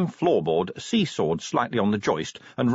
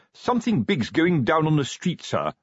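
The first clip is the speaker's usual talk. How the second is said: earnest sergeant, pitched up; 7 semitones higher than usual. A narrator's reading. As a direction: deliver it like a memorised phrase. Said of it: "said Fred earnestly".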